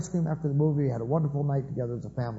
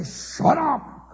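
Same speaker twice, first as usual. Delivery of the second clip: put-on much higher, sharp kid's snap; mock snapped shut up; 11 semitones higher than usual. Kid snapping at sibling, mock angry. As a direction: spit out the words like a bratty kid.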